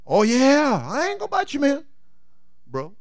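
high sly snake promise. Acted. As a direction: swear it sweetly, pitched high.

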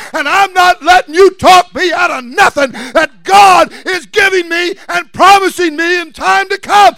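loud, high preaching shout, building; full-force preaching. Real, not acted. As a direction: build to a driving sermon climax.

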